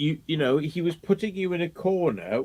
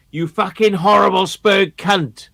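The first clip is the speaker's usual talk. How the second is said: louder, higher snarling jeer; nasty mock insult; 8 semitones higher, 9 dB louder than usual. Judge's taunt retold, louder nasty mock snarl. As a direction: spit the insult up high. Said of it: "such a fucking nasty manner".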